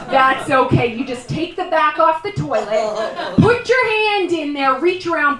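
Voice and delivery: ordinary talk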